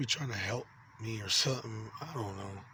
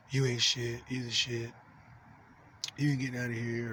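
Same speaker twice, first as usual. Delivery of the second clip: ordinary talk, nothing marked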